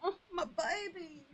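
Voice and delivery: high, slow, teary coo; tender coo. Real, not acted. Streamer coos tearfully as her cat appears.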